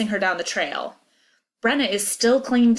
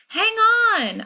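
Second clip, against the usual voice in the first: loud, high-pitched holler; urgent high yell; 5 dB louder than usual. A narrator's reading. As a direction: holler urgently to a rider in danger. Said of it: "I holler".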